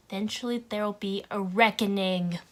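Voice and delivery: loud, hammed-up monologue; theatrical proclamation. Shown as a demonstration. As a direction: build to a big, loud finish. Overacted take on the monologue.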